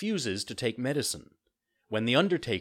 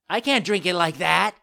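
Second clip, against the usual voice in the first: high, loud puppet complaint; whining excuse; 10 semitones higher, 9 dB louder than usual. A narrator's reading. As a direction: complain like a fussy child.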